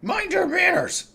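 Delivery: high, loud scolding shout. Acted. Kashan scolding his frog father, loud shout. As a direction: bark a reprimand at the frog. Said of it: "shouts and he goes".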